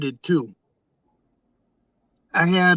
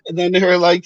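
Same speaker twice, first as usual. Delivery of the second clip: ordinary talk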